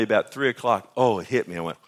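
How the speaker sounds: normal talking voice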